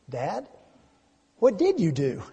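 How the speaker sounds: put-on mildly concerned little boy, hesitant pause after Dad; concerned child. Son to dad after silence, mildly concerned. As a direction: ask gently, with a touch of worry. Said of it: "he asked in a concerned voice".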